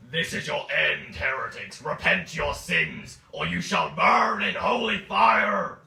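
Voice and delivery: slow, high, booming fury; furious zealot shout. Acted. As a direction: thunder it like a fanatic preacher. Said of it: "He's a very angry boy".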